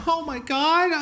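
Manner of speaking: high-pitched fake astonishment; mock shock. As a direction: imitate an owner acting stunned. Mimicking dog owners' feigned shock.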